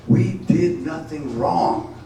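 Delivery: drawn-out protest cry; slow, loud defiant refrain. Acted. Defiant refrain repeated slower.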